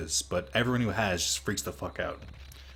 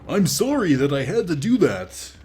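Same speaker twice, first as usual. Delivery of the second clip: goofy hero voice, pitched up; Angemon voice; 9 semitones higher, 6 dB louder than usual. Retold or imitated. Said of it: "That's my Angemon voice".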